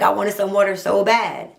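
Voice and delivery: ordinary delivery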